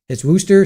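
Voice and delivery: "Worcester" said as "woo-ster", no middle syllables; local Worcester. Shown as a demonstration. Massachusetts local correcting a chatter, "Worcester" as "woo-ster". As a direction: clip "Worcester" down to two sounds, "woo-stuh". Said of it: "you say Worcester".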